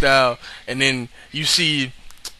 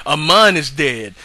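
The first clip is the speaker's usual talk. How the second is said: ordinary delivery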